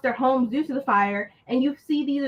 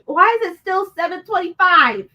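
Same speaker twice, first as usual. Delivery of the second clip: indignant; 6 semitones higher, 6 dB louder than usual. Real, not acted.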